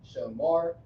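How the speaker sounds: "shamar" said loud and clear, sha-MAR; ancient Hebrew shamar. Shown as a demonstration. Teacher demos ancient Hebrew word for students, clear and loud. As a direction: spell out "shamar" for the learner. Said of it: "this is how you say keep".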